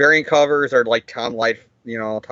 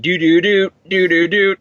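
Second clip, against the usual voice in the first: a short sung da-da-da theme jingle, a touch louder; humming the ESPN jingle; 3 semitones higher, 5 dB louder than usual. Retold or imitated. Mocking the ESPN alert going off every five seconds, hummed jingle. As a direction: hum the sports-channel sting like the TV does. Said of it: "hearing E S P N going".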